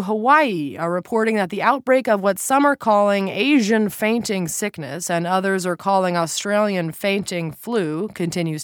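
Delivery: crisp TV anchor delivery; news anchor read. Acted. Newscaster read.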